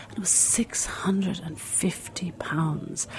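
hushed, breathy whisper of a shocking price. Real, not acted. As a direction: drop to a whisper as if telling a secret.

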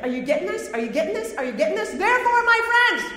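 excited questions building to a high, loud cry; acted-out excitement, rising to a proclamation; excited author. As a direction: build insistently, then burst out loud and high. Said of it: "'cause he's so excited".

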